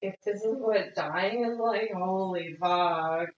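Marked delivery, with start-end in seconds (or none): 1.9-3.3 drawn out in awe; holy fuck, slow and stunned; slow awed swear. Real, not acted.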